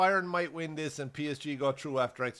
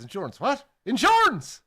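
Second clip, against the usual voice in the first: mock shout, much higher and louder; retold shout; 10 semitones higher, 9 dB louder than usual. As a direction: bellow it at the deaf old man. Cop shouting at deaf driver.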